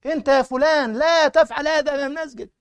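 angry rebuke. Retold or imitated. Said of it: "وهو مغضبا".

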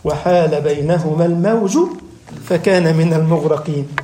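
grave slow chant of the verse; slow low recitation. Acted.

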